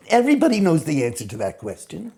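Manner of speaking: ordinary delivery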